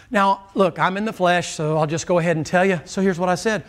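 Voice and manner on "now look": normal talking voice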